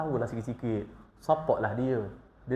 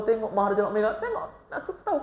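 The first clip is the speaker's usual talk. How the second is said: words breaking into laughter; laughing while preaching; 6 semitones higher, much faster than usual. Real, not acted. Preacher joking about comedy shows, laughing through it. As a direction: let the laugh bubble through.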